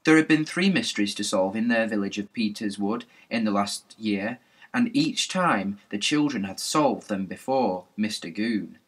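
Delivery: usual speaking voice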